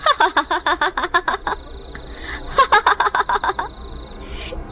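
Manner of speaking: high, long villainous cackle; evil witch laugh. Acted. Witch mocks the brothers' escape plan, evil cackle. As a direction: cackle in gloating bursts.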